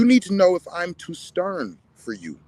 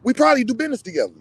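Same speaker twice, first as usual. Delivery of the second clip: higher, louder and fast; quick exasperated burst; heated outburst; 8 semitones higher, 6 dB louder, much faster than usual. Real, not acted. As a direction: fire it off in exasperation. Ranting at critics.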